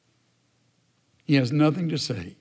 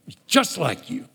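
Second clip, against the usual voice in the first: repeated sulky jab; 3 semitones higher than usual. Acted. Jonah repeating his complaint to God, sulky. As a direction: toss the line off again with a pout.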